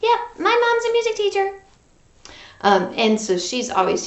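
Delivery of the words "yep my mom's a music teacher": high, fast little frog voice; Froggy puppet voice. Pretend.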